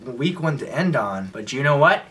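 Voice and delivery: ordinary talk, nothing marked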